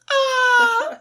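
piercing high shriek; high terrified scream. Retold or imitated. Man startled by an animal on his foot, piercing scream. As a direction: shriek at the top of your lungs as something runs over your foot. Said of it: "I screamed at the top of my lungs".

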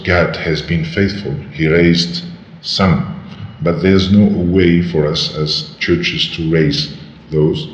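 normal talking voice

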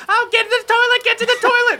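put-on very high, urgent kid voice; high-pitched frantic urging; rushing youngins. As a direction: squeal it like a kid on a mission. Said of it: "And you hear, like".

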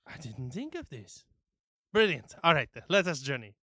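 loud, quick burst of delight; a shopkeeper thrilled by the plan; excited NPC. Acted.

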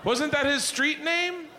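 put-on high, unsure kid's voice; sheepish daughter. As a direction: imitate a daughter asking in high-pitched doubt. Daughter sheepishly admits her answer.

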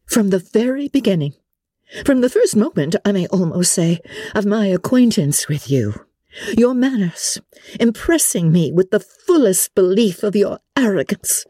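high, insistent condemnation in measured phrases; indignant Elizabeth, denouncing. Acted. Elizabeth condemns Darcy's arrogance to him, indignant. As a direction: build the indictment phrase by phrase, pitched high.

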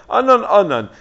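Anan, Anan called out loud and high, each falling; a pointed slight, name said twice; curt double name. Shown as a demonstration. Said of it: "Say his name twice".

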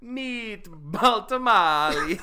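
slow, laughing mock Italian accent. Cohost keeps the bit going, laughing Italian accent. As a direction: stretch it out in a silly Italian accent, cracking up. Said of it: "stupid Italian accents".

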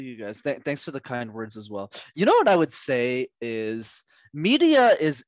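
ordinary delivery

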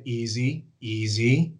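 low, slow soothing dog command; quiet, calm send-off. Retold or imitated. Trainer sending dog on short mark, calm. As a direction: settle the dog as if putting it to bed. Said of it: "with the quietest, calmest, like she's trying to put 'em to bed".

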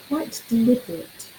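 ordinary talk

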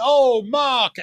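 high, loud delighted "Oh, Mark"; gushing warm greeting. Retold or imitated. The vicereine, warmly greeting a friend before a hug. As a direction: greet an old friend fondly. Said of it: "she just went".

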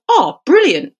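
imitating an overeager, cheery player; mock delight. Player greeting the ref with hammed-up delight.

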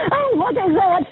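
sudden high startled gasp of fear; alarmed cry, voice jumping up; panicking. Real, not acted. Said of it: "I know I shouldn't be panicking".

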